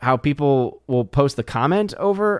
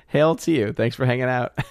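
ordinary talk

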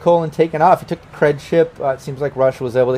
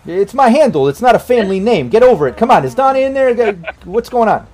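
louder, higher exasperated Bronx guy; impatient Bronx brother, pitched up; Bobby Bourbon, exasperated; 8 semitones higher, 4 dB louder than usual. Acted. Brother on the phone demands his sister, exasperated Bronx voice. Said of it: "from the Bronx".

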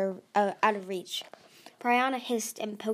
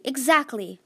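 higher, louder agreement; desperate; 4 semitones higher, 7 dB louder than usual. A narrator's reading. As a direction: insist on it urgently. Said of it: "Tsunami said desperately".

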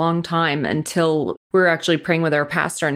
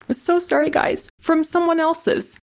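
apologizing while fighting back tears; tearful apology; 10 semitones higher than usual. Real, not acted. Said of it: "getting so emotional on that".

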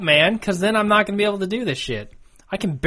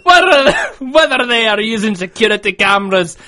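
loud, higher-pitched broad Scots; Scottish accent; 4 semitones higher, 4 dB louder than usual. Pretend. Podcaster reading listener mail on request, broad Scottish accent. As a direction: declaim it in a rolling Scottish burr. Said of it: "do a Scottish accent, but I'll try".

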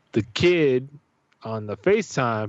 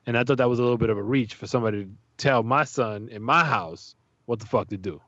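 ordinary delivery

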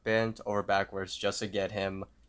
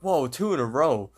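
higher, louder excited whoa; 5 semitones higher, 6 dB louder than usual. Retold or imitated. Lottery player matching two boxes, excited. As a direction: act out mounting excitement. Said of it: "starts getting excited".

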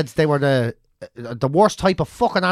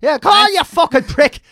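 higher, louder hammed-up terrace abuse; imitating angry fan; 9 semitones higher, 3 dB louder than usual. Fan insisting he's real and abusing the team, higher and louder mock shouting. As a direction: rant like a bitter supporter. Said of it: "He's like".

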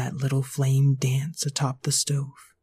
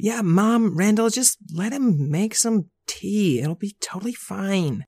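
put-on higher little girl pleading; little sister in a higher pitch; baby girl voice; 6 semitones higher than usual. Babs backs up Buster.

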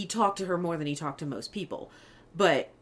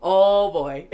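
put-on laughing oh boy; 5 dB louder than usual. Remembered reaction, laughing.

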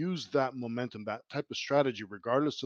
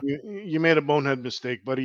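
louder, higher telling-off of a player; mock scolding; 4 semitones higher, 7 dB louder than usual. Mock scolding Kerfoot, pretending to talk to him directly, louder and higher. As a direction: chew him out like a coach.

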